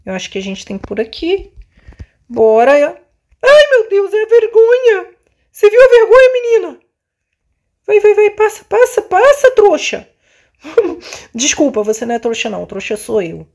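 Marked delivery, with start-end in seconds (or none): 2.7-10.1 high frantic yells; shout, hurry, get through; panicked. Real, not acted. Embarrassment chasing in game. 10.7-11.3 laughing. Real, not acted. Laugh after calling someone dumb.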